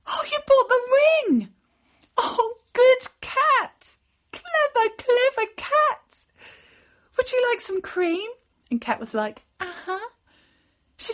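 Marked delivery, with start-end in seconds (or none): 0.0-8.3 put-on high, loud, gushing girl; delighted pet praise. A narrator's reading. Girl thanks and praises her cat. 9.6-10.1 small, high cat reply; contented cat. A narrator's reading. Cat answers the offer.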